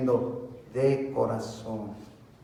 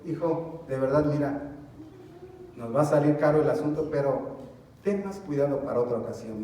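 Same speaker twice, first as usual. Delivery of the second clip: gentle parent; 4 dB quieter than usual. Acted. A father gently correcting his son after the spill, soft and patient. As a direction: talk the boy through it kindly, much softer.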